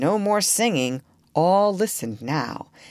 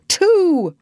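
solemn; 14 semitones higher, 7 dB louder than usual. A narrator's reading. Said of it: "said Slightly solemnly".

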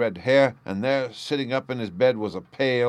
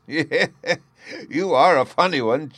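amused chuckle, louder and higher; chuckling; 6 semitones higher, 6 dB louder than usual. Acted. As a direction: inspector chuckling at the sleeping prisoner, amused. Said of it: "chuckled the inspector".

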